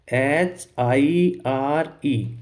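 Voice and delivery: very slow letter-by-letter spelling. Shown as a demonstration. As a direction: spell each letter out, drawn out and separate. Teacher spells out the word. (एक-एक अक्षर धीरे-धीरे बोलकर)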